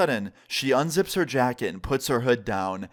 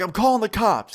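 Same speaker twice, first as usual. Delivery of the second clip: mild mock yell, raised pitch; retold yell; 7 semitones higher, 4 dB louder than usual. Yelling down at her from the balcony, retold yell. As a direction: call it down as an acted threat. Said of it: "I just yell back down at her".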